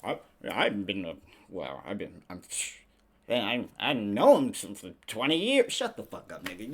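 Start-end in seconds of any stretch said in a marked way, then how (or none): 0.0-5.7 fast, flustered denial growing loud; stammering celebrity. Retold or imitated. Podcaster mocking celebrities denying, flustered to loud. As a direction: fumble the excuse then insist. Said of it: "That's what all the celebrities are".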